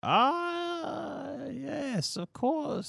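put-on high, soft, slow old man reminiscing; very old grandpa voice, wandering story; rambling ancient old man. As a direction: drift off into an old memory, doddering.

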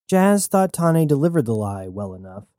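ordinary delivery, nothing marked

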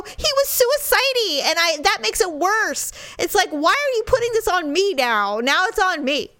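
high-pitched, worked-up protest; upset. Retold or imitated. Wife arguing with husband about squirrel, upset. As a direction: protest as if near tears, retold. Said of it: "I was upset".

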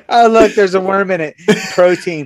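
high mock-brave whimper; acting tough, tearfully. Boy finding worm in apple, mock tearful bravado. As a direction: pretend all is well through fake tears. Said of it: "Trying to act tough, eating the apple".

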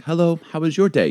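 put-on high-pitched little boy greeting; young boy. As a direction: imitate a polite small child. Voicing friends' very young son.

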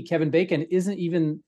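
ordinary talk

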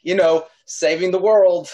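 flustered rush to get away; flustered outburst. Real, not acted. Player blurting an excuse to flee the villain.

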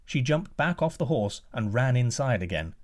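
ordinary delivery, nothing marked

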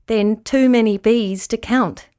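ordinary delivery, nothing marked

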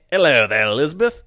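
loud, hammed-up Australian greeting; goofy Australian Philip. Host mocks Philip greeting Elizabeth in a goofy Australian accent. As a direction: ham up an Aussie Prince Philip. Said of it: "Phil coming back from Australia".

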